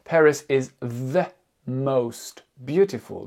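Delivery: Paris is the most beautiful said very slowly; teacher modelling slowly; slow model phrase. Shown as a demonstration. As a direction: spell out most beautiful deliberately. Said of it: "Voilà ce que vous allez dire".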